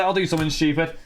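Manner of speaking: ordinary talk, nothing marked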